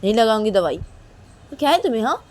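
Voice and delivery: loud, scolding, indignant; angry refusal. Acted. Angry Reshma scolding her friend Kajal. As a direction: snap back at her for touching the suit.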